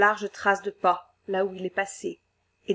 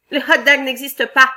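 loud, scoffing boss; mocking; 5 semitones higher, 10 dB louder than usual. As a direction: sneer at the silly fear. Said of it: "se moqua le patron".

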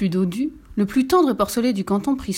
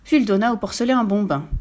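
usual speaking voice

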